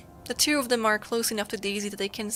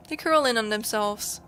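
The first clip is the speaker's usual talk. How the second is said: usual speaking voice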